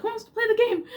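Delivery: high, loud, laughing invitation; gleeful mock call to play; eager call. Siblings calling each other to candle game, laughing eagerness.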